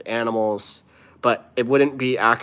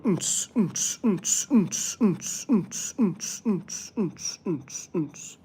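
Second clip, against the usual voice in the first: club beat; 8 semitones higher, much slower than usual. Pretend. Maybe we can all go clubbing.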